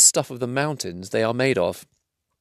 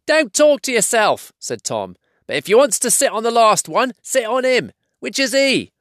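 squeaky quarrelsome troll; 13 semitones higher than usual. Acted. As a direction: bicker in a high, peevish troll voice. Tom's troll voice.

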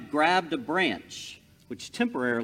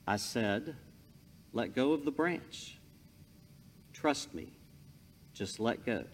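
calm God voice; 3 semitones lower, 7 dB quieter than usual. Acted. God repeats the command.

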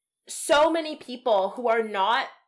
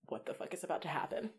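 hushed disbelief; 4 semitones lower, 15 dB quieter, faster than usual. Retold or imitated. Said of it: "everybody is like".

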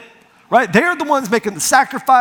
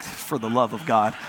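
weary exasperation; 10 semitones lower, 5 dB quieter than usual. Retold or imitated. Muttered reaction to clueless parents.

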